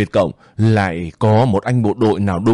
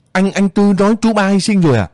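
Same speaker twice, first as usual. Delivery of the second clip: shocked disbelief; 9 semitones higher, faster than usual. Acted. Shocked question at death news.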